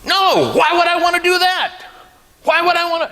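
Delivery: dad making a scene in the pew; loud mocking refusal. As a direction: make a scene, scoff at the very idea. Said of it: "And he was making a scene".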